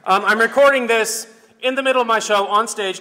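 calling out loud and high over a noisy crowd; loud stage greeting. Acted. Host on stage greeting fans back home, loud shout.

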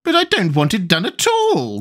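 high, plaintive groan of a poor queen. A narrator's reading. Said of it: "groaned the poor Queen".

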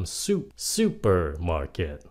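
SUPERMARKET slowly, stressed on SU; stress on first syllable. Shown as a demonstration. Teacher corrects the stress, slow SU-per-market. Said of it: "nhấn âm một mà không phải là âm mark".

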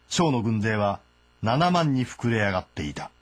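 usual speaking voice

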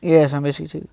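ordinary talk

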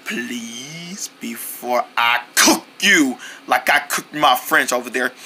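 low, menacing spooky threat; a low creepy villain voice. Acted. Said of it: "you sounded really spooky there".

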